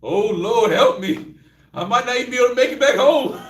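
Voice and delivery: hammed-up worry on the drive; mock fear. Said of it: "You gonna be like".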